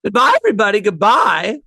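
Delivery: high, loud catchphrase farewell; sketch catchphrase. Pretend. Doing his catchphrase for the hosts, big sketch-character voice. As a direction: sing out a showy goodbye to the room. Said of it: "Do your catchphrase".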